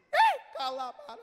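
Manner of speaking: sudden high, loud yelp; imitated scream. Woman on the plane crying out at prayer, imitated scream. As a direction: mimic a piercing cry that bursts out. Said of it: "ela deu um grito".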